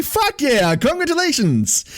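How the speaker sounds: high, delighted shout of congrats; excited cheer. Real, not acted. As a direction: cheer them on, pitch way up. Cheering a viewer's tournament win.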